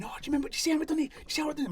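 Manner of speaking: high, breakneck gush of pride; excited boasting. Retold or imitated. Teenager bragging to his mates after the fight, high excited rush. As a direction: imitate a giddy teenager bragging, pitched high.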